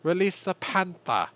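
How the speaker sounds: high, loud mock German Hitler voice; German accent. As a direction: ham it up like a ranting German dictator. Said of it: "And he was like".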